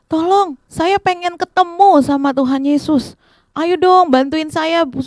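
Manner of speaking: high, insistent plea; calling for help. Acted. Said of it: "Bartimeus semakin lagi teriak". (memohon dengan seruan bernada tinggi)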